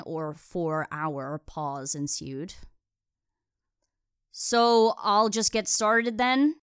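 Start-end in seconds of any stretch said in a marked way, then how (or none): 4.3-6.6 slow, loud, higher call; drawn-out raised voice rising at the end. Acted. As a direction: call it tentatively after an awkward pause. Still calling across the lawn.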